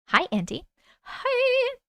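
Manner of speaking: ordinary talk, nothing marked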